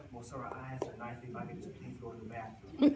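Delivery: low, laughing mock of a sweet-talking student; whiny student voice. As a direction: imitate a student playing innocent, lightly. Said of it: "if in my class".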